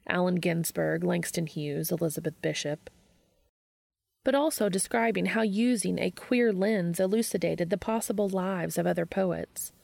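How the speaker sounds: ordinary delivery, nothing marked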